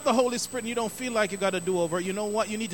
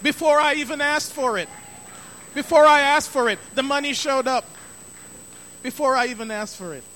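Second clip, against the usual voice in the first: excited praise; 4 semitones higher, 7 dB louder than usual. Real, not acted. Praising God for paid bills.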